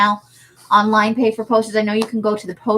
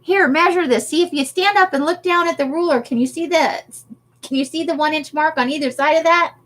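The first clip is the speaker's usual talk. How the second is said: higher, sing-song teacherly instructions; mock instructing voice; 7 semitones higher than usual. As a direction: talk someone through measuring, pitched up. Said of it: "we're like".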